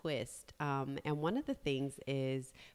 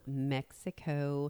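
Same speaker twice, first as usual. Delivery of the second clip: ordinary talk, nothing marked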